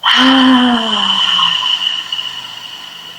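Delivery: long voiced exhale, falling away; big releasing sigh; long audible sigh. Shown as a demonstration. Breathing out during breathing exercise.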